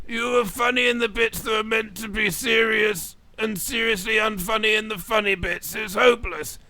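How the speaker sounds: high, monotone critique; blunt, deadpan old-teacher voice; addled old teacher. Acted. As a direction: drone the verdict on a high note.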